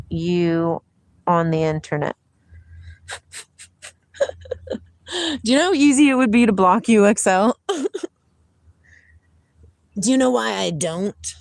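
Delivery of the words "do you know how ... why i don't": sassy teasing. Real, not acted. Teasing a chat troll.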